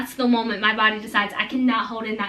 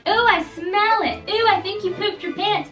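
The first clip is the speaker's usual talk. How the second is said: high, slow singsong taunt; teasing classmates; 8 semitones higher, slower than usual. Retold or imitated. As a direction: drag out the teasing ooh. Classmates tease Bud.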